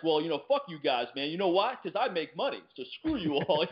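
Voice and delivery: a comic snapping back at hecklers, mock-indignant; indignant retort. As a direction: fire back at the crowd, offended. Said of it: "he became very indignant".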